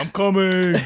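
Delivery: Dayla's heroic reply. Retold or imitated. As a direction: call out as the rescuer, hammed up. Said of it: "trying to have a different voice".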